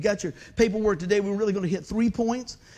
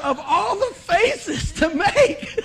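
laughing disbelief; 10 semitones higher, 7 dB louder, much slower than usual. Retold or imitated. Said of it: "I thought".

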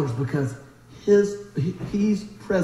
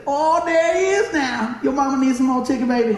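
chicken-counter ladies; 5 semitones higher than usual. Retold or imitated. Counter ladies greet their regular customer, hammed-up high welcome. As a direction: imitate delighted counter ladies calling out.

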